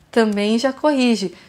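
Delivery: usual speaking voice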